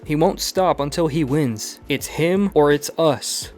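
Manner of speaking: a touch of frantic insistence; desperate urging. Acted. Harry urging Peter, desperate.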